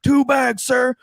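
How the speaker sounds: high, sung-out group response; recruits' chorus. Retold or imitated. Recruits answering their drill sergeant in unison, called out. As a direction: call it back like an obedient recruit.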